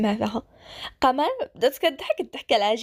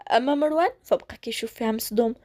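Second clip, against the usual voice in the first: ordinary delivery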